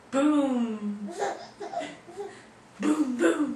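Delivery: big loud booms; loud booming. Acted. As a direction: boom it out loudly. Booming sound review.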